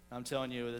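usual speaking voice

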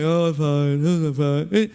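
put-on low, mumbling sulky kid; sulky child. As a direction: mimic a moody child brushing it off. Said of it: "you have a child, you're like".

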